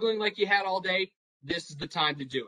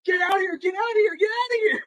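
high, loud shouted "get out of here", repeated, rising higher; frantic shout; 8 dB louder, faster than usual. Retold or imitated. Managers frantically shooing players from a fight, shouting high and loud. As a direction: yell it like you're frantically waving players off. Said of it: "frantically waving them off".

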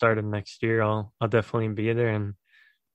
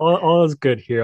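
playful loud reply; 6 semitones higher, 8 dB louder than usual. Acted. Answers the big greeting in kind.

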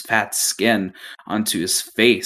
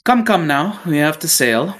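higher, louder brisk urging; brisk captain; 8 semitones higher, 4 dB louder than usual. Acted. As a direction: hurry them along cheerily. Captain urges crew aboard.

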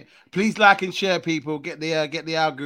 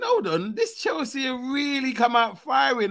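surprised; 8 semitones higher than usual. Retold or imitated. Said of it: "I was thinking".

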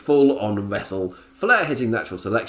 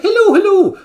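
put-on very high, very loud leprechaun Irish accent; over-the-top Irish; 12 dB louder than usual. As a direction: ham up a cartoon Irish greeting. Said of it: "full offensive Irish, leprechaun stuff".

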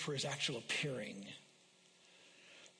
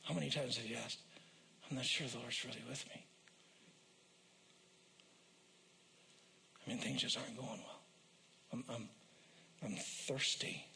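lower, softer, halting lament; hushed doubting voice; 4 semitones lower, 7 dB quieter than usual. Acted. Pastor voicing a struggling believer's doubt, hushed and halting. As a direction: voice someone's quiet despair.

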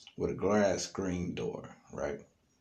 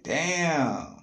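impressed damn; 9 semitones higher, 6 dB louder than usual. Retold or imitated. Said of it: "I'm like".

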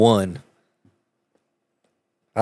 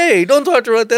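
much higher, louder indignant protest; imitating mad friend; 14 semitones higher, 5 dB louder than usual. Friend defends his girl in the car, high indignant imitation. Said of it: "so mad at me".

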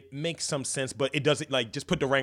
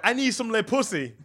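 high, loud silly French plea; cartoon French lover's bold demand; mock French demand; 10 semitones higher, 6 dB louder than usual.

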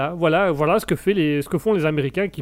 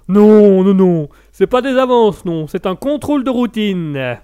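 much louder, higher defensive protest; 4 semitones higher, 9 dB louder, slower than usual. Retold or imitated. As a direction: shout the denial. Officer protests loudly.

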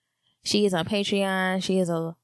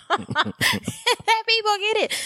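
high, slow laughing words; much slower than usual. Real, not acted. Laughing while speaking.

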